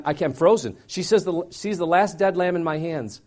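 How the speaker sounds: normal talking voice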